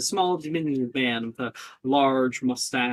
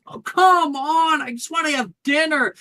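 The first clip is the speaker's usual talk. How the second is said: whiny student; 10 semitones higher than usual. Acted. GM voices grumbling student.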